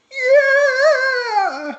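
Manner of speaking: long, high, loud "yeah" scream; imitated crowd cheer. Imitating the crowd at Craig's high note, screamed cheer. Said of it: "you just hear crowd noise go".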